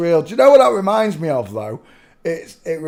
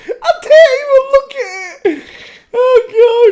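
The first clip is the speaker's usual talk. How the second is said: very high, loud voice squeezed by real laughter; helpless laughing; 8 dB louder, slower than usual. Real, not acted. Host reacting to a funny picture, laughing helplessly. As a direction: gasp it out while cracking up.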